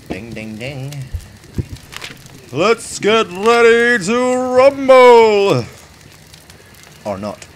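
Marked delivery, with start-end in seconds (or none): 2.5-5.6 drawn-out ring announcer call; boxing announcer. Pretend.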